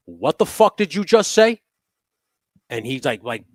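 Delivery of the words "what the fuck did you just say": loud, high, furious confrontation; angry yell. Retold or imitated. Angry yell at the old man who called him an asshole. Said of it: "I turn around and I say".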